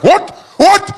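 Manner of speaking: very high, loud outraged shout, slow at first; furious Afrikaner. Retold or imitated. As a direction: defend your friend at full fury. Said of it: "Be like".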